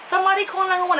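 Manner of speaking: high, urgent call for help; mock panicked plea. Calling to the news reporter's microphone in mock panic. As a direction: beg the reporter for help, hammed-up. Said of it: "I'm like".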